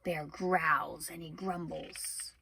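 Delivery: low, soft growling bear. A narrator's reading. Bear growling, read dramatically.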